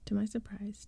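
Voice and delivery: ordinary delivery, nothing marked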